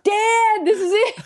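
high-pitched imitation of an excited son; thrilled son. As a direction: burst out in hammed-up awe, voice way up. Imitates thrilled future son-in-law.

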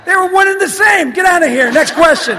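high, very loud dismissal; mock angry shout. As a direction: bellow them away in mock outrage. Comic dismissal of the fan.

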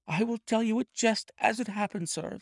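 cowed clerk owning up; frightened thief confessing. A narrator's reading. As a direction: confess fast before he changes his mind. Ryder's voice held.

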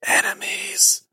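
slow, urgent phantom whisper; a dead voice begging in a whisper; ghostly whisper. Acted. Ghost warns the player to hold the door, whispered.

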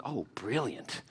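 low, hushed aside to himself; Haman's private delight. Acted. Haman privately relishing the king's request, low hushed delight.

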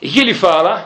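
ordinary talk

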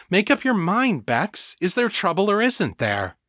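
high, impatient demand; exasperated. A narrator's reading. Danny pressing Bex to make up her mind, exasperated. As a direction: pitch it up in exasperation. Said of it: "Danny made an exasperated sound".

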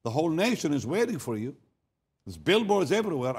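high-pitched, indignant retold outburst; retold anger. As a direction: imitate an angry, incredulous protest. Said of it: "He got very angry".

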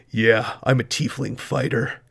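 gruff tiefling fighter. Shown as a demonstration. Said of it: "just have deeper, gruffer voices".

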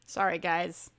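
ordinary talk

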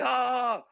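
high loud mock moan of pleasure; hammed-up cry of pleasure, loud and high. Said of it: "she's like going".

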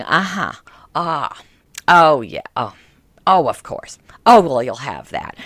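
smoky, attentive uh-huhs; a ragged aunt nodding along; old aunt murmuring. Retold or imitated. Aunt listening, smoking.

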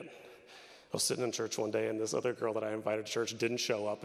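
ordinary talk, nothing marked

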